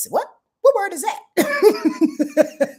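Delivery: high, slow puzzled squeak. Real, not acted. Astrologer baffled by her own misreading, slow and high. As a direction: stretch it out in comic disbelief at the word.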